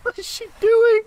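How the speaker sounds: high, loud disbelief; incredulous at the screen; baffled cry. Real, not acted. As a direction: ask it in outraged disbelief. Baffled at player's move.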